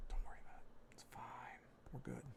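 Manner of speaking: barely audible, talking yourself out of worrying; hushed self-reassurance. Real, not acted. Artist reassuring himself over the drawing, in a hushed whisper.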